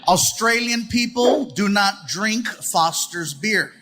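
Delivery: normal talking voice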